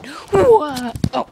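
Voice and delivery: sudden high startled whoa; surprised yelp. Real, not acted. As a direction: yelp mid-fall. Jumping over couch, fails.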